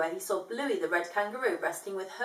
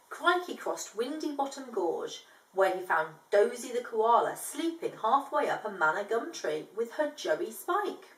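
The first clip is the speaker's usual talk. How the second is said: ordinary delivery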